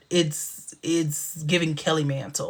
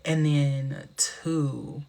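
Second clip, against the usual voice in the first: ordinary talk, nothing marked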